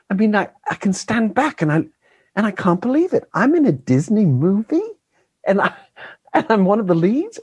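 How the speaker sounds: put-on high-pitched, wondering child's voice; English child. Actor retelling young co-star's amazement, high child voice. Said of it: "one of the children was telling me".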